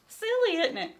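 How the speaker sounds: high, loud and silly; playful. Acted. Teacher giggling with the children, high. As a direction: sing it out playfully.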